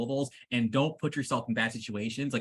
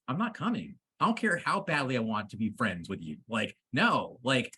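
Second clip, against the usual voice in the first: ordinary talk, nothing marked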